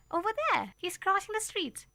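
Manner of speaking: high, eager pointing; pointing him out, breathless with excitement; girl showing her mother where he goes; eager child. A narrator's reading.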